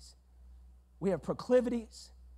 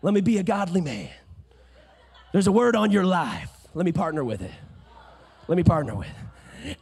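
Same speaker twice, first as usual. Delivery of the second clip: louder, laughing flirty husband; 4 semitones lower, 5 dB louder than usual. Retold or imitated. Husband Elkanah flirting with his wife, playful and louder. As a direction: tease your wife with a grin in the voice. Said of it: "He said".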